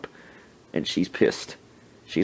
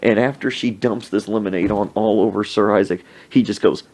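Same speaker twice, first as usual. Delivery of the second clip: normal talking voice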